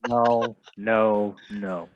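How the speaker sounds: ordinary talk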